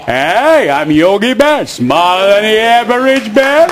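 slow, drawn-out cartoon bear with attitude; Yogi Bear impression. Comedian introduces his childhood hero, cartoon bear voice. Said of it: "Yogi always had that attitude".

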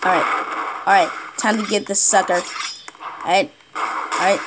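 low, soft muttering to oneself; hushed concentration. Real, not acted. Player about to get this sucker, hushed focus. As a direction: keep it under your breath while lining up. Sneaking up on a target.

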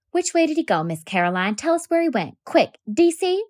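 put-on a boy rushing to catch a mouse for his teacher; eager boy. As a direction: urge them to hurry, keen to help.

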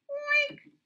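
loud, high oink; pig oink. A narrator's reading. Pig answering Roy with a loud, high oink. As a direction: snort out a piggy oink.

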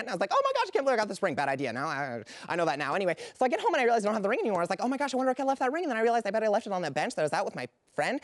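rushed, breathless-sounding run-on chatter; frantic rambling. Acted. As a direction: gabble the whole story without a gap. Frantic stranger rambling about lost ring.

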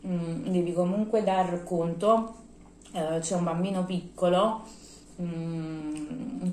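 talking with food in mouth; muffled chewing speech; mouth full chewing. Real, not acted. Seen 2: bites food at -3s, cheeks bulge chewing throughout speech.

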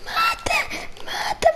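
very high, squealed battle cries; frantic shrieking. Real, not acted. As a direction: screech the orders in a gaming frenzy. Frantic fight with fish in game.

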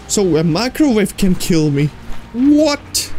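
usual speaking voice

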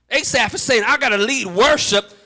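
full-force preaching, driving repeated phrases into a shout; fervent, chanting sermon cadence. Real, not acted.

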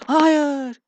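high, loud cry of defeat; anguished shout. Real, not acted. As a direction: yell it at the screen as all is lost. Last sword lost, cries out.

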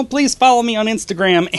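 ordinary delivery

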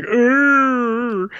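high, loud, drawn-out urgh; strained goofy groan. Retold or imitated. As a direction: groan like holding back a smile. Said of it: "looks like he's making that sound".

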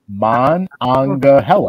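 "Mon Anga Hella" slowly, in separate pieces; broken down. Shown as a demonstration. Man copying her breakdown of the river name, piece by piece. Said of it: "She broke it down".

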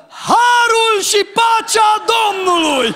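man shouting a church greeting at friends in a phone shop; loud greeting. Retold or imitated. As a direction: call it across the shop. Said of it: "și strigă".

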